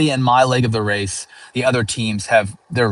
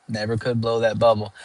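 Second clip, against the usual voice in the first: normal talking voice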